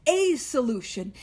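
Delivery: "a" said as a full "ay", punched ahead of solution; stress on A. Shown as a demonstration. Stressing one solution to the meeting, "a" punched. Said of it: "I'm gonna start with emphasizing a solution".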